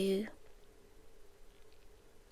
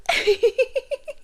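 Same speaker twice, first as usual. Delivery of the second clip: soft laugh; 12 semitones higher, 4 dB quieter than usual. Acted. Stalker laughs at victim's bad book.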